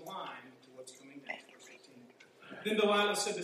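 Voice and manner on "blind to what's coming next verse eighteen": whispered, leaning in with a hint of doom; dramatic whisper. Real, not acted. Preacher warning his listeners of doom, whispered. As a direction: drop to a whisper as if warning of what comes.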